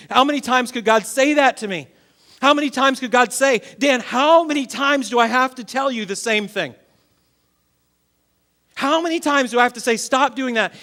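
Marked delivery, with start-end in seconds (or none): 3.8-10.7 mock exasperation, raised and louder; imagine God losing patience, mildly acted; frustrated scolding. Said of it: "lose it and get frustrated with me".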